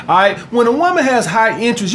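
normal talking voice

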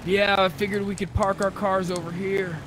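normal talking voice

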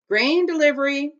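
loud, high shout up to someone; calling out. A narrator's reading. Scholar shouts to the guard in the tower, calling out. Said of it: "the scholar shouted up to the guard".

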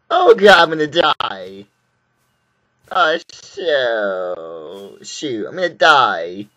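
loud, drawn-out cry of doom; mock doomed wail. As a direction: wail it out, dragging the last word. Facing imminent death in the game.

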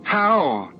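high, betrayed accusation; anguished cry. A narrator's reading. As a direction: cry out at someone who let you down. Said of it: "she cried".

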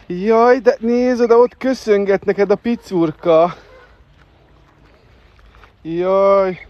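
high, loud gushing over the birds; delighted. Real, not acted. To the viewers about the little bird waving, high loud gushing.

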